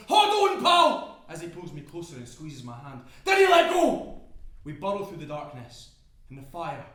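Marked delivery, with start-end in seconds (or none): 0.1-1.2 very loud, high urgent yell. Acted. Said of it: "he yells". 3.2-4.2 high, full-voiced urgent yell. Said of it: "he yells".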